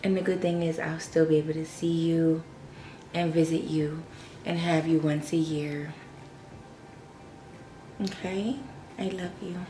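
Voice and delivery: soft, quiet farewell, holding back tears; whisper-soft goodbye to the puppy; tender goodbye. Real, not acted. Owner saying a tearful farewell to her dog Fendi, soft and quiet.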